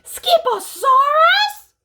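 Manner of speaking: very high, loud, slow disbelieving echo; incredulous. A narrator's reading. Mother catching him with the bones, incredulous. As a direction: stretch the word in disbelief. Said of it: "declared Mama Junebug Jones".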